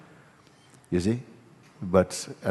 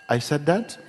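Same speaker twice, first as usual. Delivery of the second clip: high incredulous question, rising at the end; mock surprise; 8 semitones higher than usual. Mother confronted by her child over a judgment, incredulous. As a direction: imitate a mother caught out by her own words.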